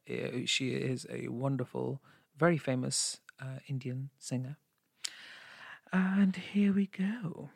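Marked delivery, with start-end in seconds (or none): 5.9-7.5 very slow, whispery lead-in; hushed performer's cue. Pretend. Singer about to perform, hushed drawn-out cue. Cueing own song.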